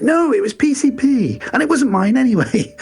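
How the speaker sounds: squeaky upper-class indignation; high indignant correction. Retold or imitated. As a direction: correct them in a high affronted voice. Aykroyd's character correcting the guards.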